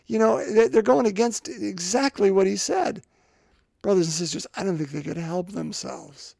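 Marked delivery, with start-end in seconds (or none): 4.6-6.4 quiet. Shown as a demonstration. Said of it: "repeated line".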